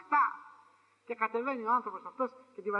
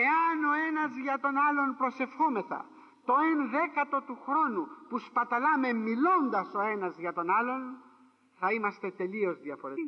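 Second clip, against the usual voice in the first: slow, louder exhortation; solemn preaching; 3 semitones higher, 3 dB louder than usual. Acted. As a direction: press the point slowly to the flock. (κήρυγμα, αργά και με έμφαση)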